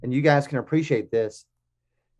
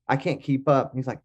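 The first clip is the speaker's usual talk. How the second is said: usual speaking voice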